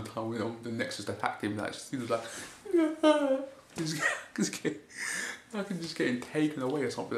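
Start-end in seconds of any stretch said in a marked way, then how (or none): 2.7-7.2 real laughter, higher voice; giggling through the words; laughing. Real, not acted. Laughing at Cena's beatdown.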